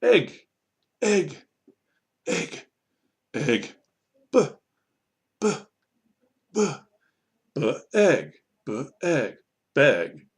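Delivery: isolated "egg" and short "B" sounds, then joined "beg"; blending drill. Shown as a demonstration. Teacher drilling blending, "B" and "egg" apart, then "beg". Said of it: "You might try".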